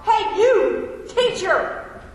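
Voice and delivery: loud, high yell from a boat. Acted. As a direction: call out across the water in fear. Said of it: "yelled out".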